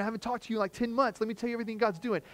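high, fast, eager rush of words; excited gushing. Retold or imitated. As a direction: gush about the church like a kid. Pastor retells excited reply to friend.

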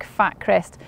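ordinary talk, nothing marked

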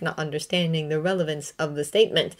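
ordinary delivery, nothing marked

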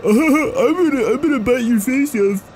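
goofy, high imitation of a biting attacker; mock attacker. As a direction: imitate the chaser, laughing and taunting. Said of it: "He's like".

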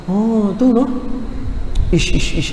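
soft, engaged listener noises; showing interest. Shown as a demonstration. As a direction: react like a fascinated listener. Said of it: "tunjukkan kita interest".

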